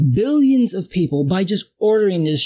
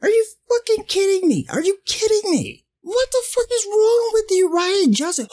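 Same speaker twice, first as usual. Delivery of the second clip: much higher, strained incredulous outrage; real fan outrage, voice pitched way up; outraged disbelief; 13 semitones higher than usual. Real, not acted. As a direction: cry it out at the director in exasperation.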